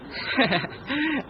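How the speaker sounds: a short amused laugh after the teasing. Acted. Husband laughing at cousin's teasing about the schoolgirl, amused laugh. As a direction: laugh it off, caught out but pleased.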